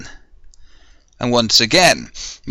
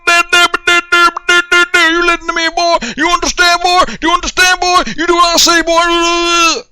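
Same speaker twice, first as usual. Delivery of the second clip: mock drill sergeant, much higher and louder; drill sergeant yelling; 4 dB louder than usual. Drill sergeant yelling at a recruit, hammed-up high shout. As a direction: scream orders at a recruit like a parody drill instructor. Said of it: "having people yelling at you".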